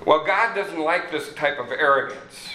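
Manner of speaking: ordinary talk, nothing marked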